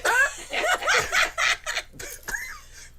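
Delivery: high, loud burst of laughter; howling laugh; loud shrieking laugh. Real, not acted. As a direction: cackle hard at the joke. Laughing at parrot joke.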